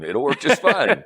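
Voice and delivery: slow, chuckling repeat of the agreement; laughing repeat. Retold or imitated. To his host, agreeing for half a million, laughing slowly.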